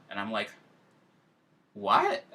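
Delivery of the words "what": shocked what. Retold or imitated. Shocked at stranger's message.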